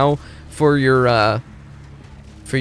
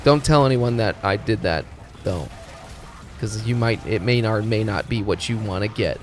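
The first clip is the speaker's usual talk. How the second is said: ordinary talk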